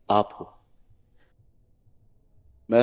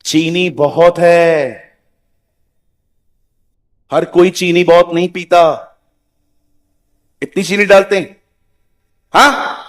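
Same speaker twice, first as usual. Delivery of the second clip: higher, louder, scolding the tea-maker; rude complaining tone; 3 semitones higher, 5 dB louder than usual. Shown as a demonstration. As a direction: complain sharply about too much sugar, rising to an indignant हाँ. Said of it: "अब देखो मेरे बात करने का tone". (चाय में ज़्यादा चीनी पर ऊँची, तीखी, शिकायती आवाज़)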